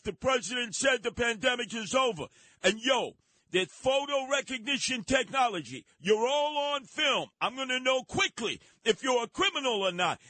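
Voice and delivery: tough-guy subway announcement. Acted. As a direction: bark it like a self-made transit sheriff. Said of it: "And this is the announcement".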